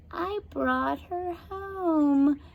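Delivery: high, slow creepy witch. A narrator's reading. Witch Beryl answers the girl, gloating slowly. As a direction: savour each word like a hag.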